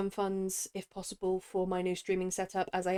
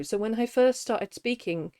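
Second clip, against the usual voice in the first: usual speaking voice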